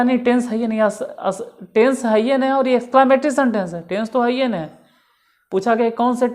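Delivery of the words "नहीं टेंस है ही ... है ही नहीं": raised, louder exasperation; incredulous at the wrong answer; exasperated. Retold or imitated. Mocking the wrong student claim. (झुंझलाकर ऊँची आवाज़ में)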